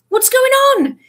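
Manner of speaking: high, loud alarmed call; startled shout. A narrator's reading. Seamus woken in the night.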